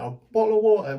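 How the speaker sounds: "A bottle of water" in a high, hammed-up posh English accent; mock Queen's English. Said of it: "only the Queen speaks the Queen's like that".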